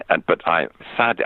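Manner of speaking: ordinary delivery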